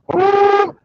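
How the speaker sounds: high, loud mock cry of alarm; imitated yelp of surprise; retold startled whoa. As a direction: let out a sudden high whoa. Retold alarm at the open microwave.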